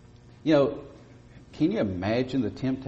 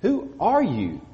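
higher, pointed voice of the questioning priests; 8 semitones higher than usual. Acted. Priests sent from Jerusalem asking John, higher and pointed. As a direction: ask it as the suspicious delegation would. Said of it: "And here's their question".

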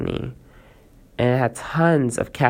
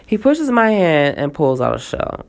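ordinary delivery

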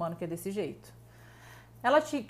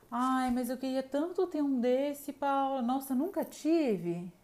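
higher, slow pleading whine; mock envious whiner; 4 semitones higher, slower than usual. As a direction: drag it out like begging for sympathy. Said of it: "aquela pessoa que fica todo o tempo". (imitando a vitimista carente, devagar e mais aguda)

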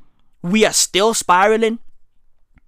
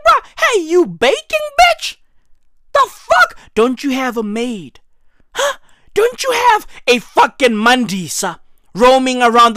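much higher, incredulous ranting voice; high mocking outrage. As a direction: squeal it out like you can't believe it. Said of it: "I be like".

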